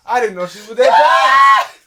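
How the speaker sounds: loud, amazed and slow; excited shout. Real, not acted. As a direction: shout it in disbelief. Amazed at aunt's speed.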